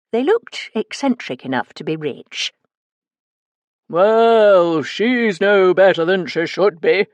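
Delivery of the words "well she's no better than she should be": loud, slow, grand theatrical posh; posh actor's voice. Acted. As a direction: declaim like a pompous stage actor. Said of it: "in his best posh actor's voice".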